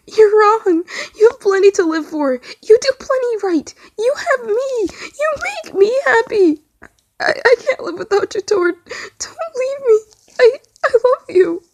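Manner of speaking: holding his friend's wrist, pleading while crying; broken wailing. A narrator's reading. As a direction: wail it through sobs, voice breaking. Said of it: "Tom wailed brokenly".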